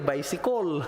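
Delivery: put-on Cebuano-accented "Bicycle", ending in a rounded "-kol". Preacher joking about regional speech, Cebuano accent. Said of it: "Ah, ganyan ba?".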